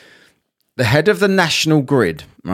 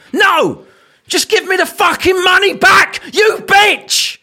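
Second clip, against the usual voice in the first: high, loud furious yell; imagined outburst at cashier; imagined shout; 13 semitones higher, 4 dB louder, slower than usual. Pretend. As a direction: scream it at her in your head. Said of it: "That's what I thought".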